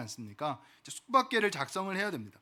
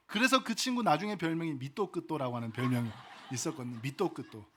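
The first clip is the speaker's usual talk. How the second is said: ordinary talk, nothing marked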